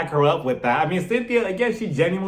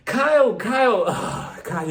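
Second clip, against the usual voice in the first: exasperated calling; 6 semitones higher, slower than usual. Retold or imitated. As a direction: call her name like you're fed up.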